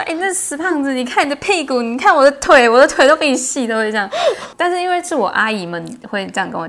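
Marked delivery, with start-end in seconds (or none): 0.0-3.7 high, loud bragging aunt; teasing aunt. Retold or imitated. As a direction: gloat like an auntie comparing legs. Imitating aunts teasing her. (高声模仿阿姨炫耀)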